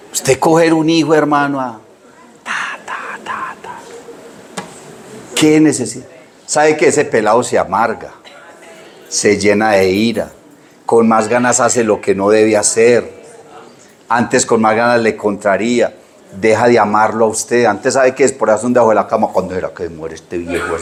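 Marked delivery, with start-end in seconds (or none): 2.4-3.8 golpes susurrados sin voz; susurro de golpes. Retold or imitated. Imita los golpes al hijo. 19.3-20.8 hijo resentido, bajo y callado; murmullo escondido. Retold or imitated. Hijo murmura debajo de la cama.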